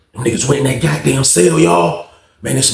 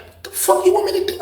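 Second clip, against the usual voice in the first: very high, softer squeal of protest; retold disbelief; 5 dB quieter than usual. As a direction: act out panicked bafflement, high and hushed. Acted disbelief at handed knife.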